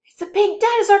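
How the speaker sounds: high, loud amazed exclaim; pretend surprise. Teacher showing the kids a pretend pink dinosaur, amazed. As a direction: sound thrilled by the discovery.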